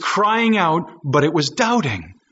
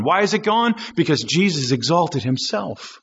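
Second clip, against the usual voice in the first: ordinary talk, nothing marked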